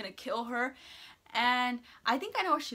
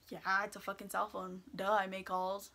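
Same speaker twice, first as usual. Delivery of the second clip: sarcastic inner thought; 4 semitones lower, 7 dB quieter than usual. Retold or imitated. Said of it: "in my head, I'm like".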